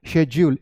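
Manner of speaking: schedule said "SHEJ-yool", slowly; correct j sound. Shown as a demonstration. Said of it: "this is schedule". (schedule का उच्चारण "शेज्यूल")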